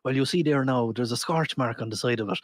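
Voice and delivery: low, easy Irish brogue; Irish accent. Acted. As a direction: say it like a Dubliner. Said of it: "he actually was said".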